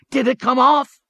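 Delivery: high, loud eager question. A narrator's reading. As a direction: press for the answer. Lenihan presses his friend eagerly.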